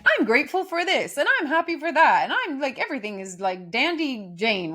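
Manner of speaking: mock chirpy, high and loud; sing-song, hammed-up forced gratitude; fake cheerfulness. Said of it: "creating a false".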